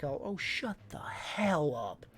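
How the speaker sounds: slow, drawn-out put-down; exaggerated dismissal. Snapping back at the man who mocked him, slow dismissal. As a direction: drag it out in exaggerated annoyance. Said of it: "I was a bit more exaggerated this".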